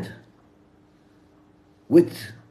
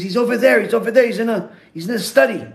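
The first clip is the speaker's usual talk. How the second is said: high, loud, repetitive blurting; acted agitation; 8 semitones higher, 5 dB louder, faster than usual. Distraught boy directs townspeople to his father, acted agitation. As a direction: imitate a distraught child urgently directing people.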